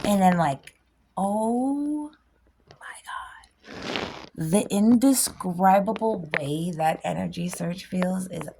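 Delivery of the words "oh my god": awed whisper. Pretend. Awed reaction to energy surge.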